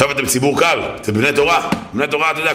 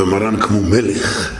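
awed admiration; 6 semitones lower, much slower than usual. Retold or imitated. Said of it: "אומר".

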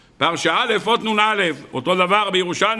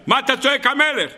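raised high, mock-thundering rebuke; retold divine shout; 6 semitones higher than usual. God rebukes the Karliner for crying King, in a high mock shout. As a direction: call it out high like God scolding. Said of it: "הקדוש ברוך הוא צועק עליי".

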